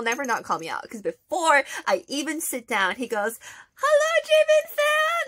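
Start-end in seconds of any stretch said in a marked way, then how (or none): 3.8-5.2 high, loud, drawn-out greeting; calling out. Retold or imitated. Idol greeting a fan before she sits, loud call-out.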